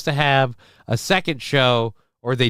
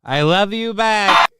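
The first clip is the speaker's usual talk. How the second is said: higher, louder, slower repeat; lightly imitated clip line; mild imitation of the clip; 7 semitones higher, 5 dB louder, slower than usual. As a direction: echo the clip mockingly. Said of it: "doing a cringe accent".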